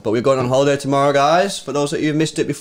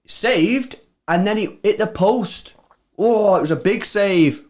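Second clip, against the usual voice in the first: high, slower excited Scottish-free disbelief at the save; excited reaction; 9 semitones higher, slower than usual. Real, not acted. Watching the chance on stream, high excited disbelief. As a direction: react high and amazed to the scramble.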